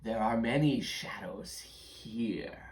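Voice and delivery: slow, whispery creature voice; shadow creature. Acted. Shadow creature questioning the little intruders, slow and whispery. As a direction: echo it eerily in a drawn-out whisper.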